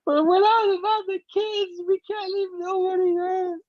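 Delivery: hammed-up tearful protest, imitating her; mock crying wife. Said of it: "and she's crying".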